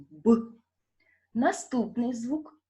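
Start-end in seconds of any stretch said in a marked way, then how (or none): 0.1-0.4 Б as a short, loud burst; isolated sound. Shown as a demonstration. Teacher modelling the sound for repetition, Б in isolation. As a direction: punch Б out alone as a bare consonant. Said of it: "Промовте його".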